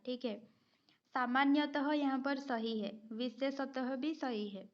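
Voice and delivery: ordinary delivery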